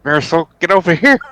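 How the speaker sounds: high, loud summons; urgent call. Acted. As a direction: call out to a companion for help.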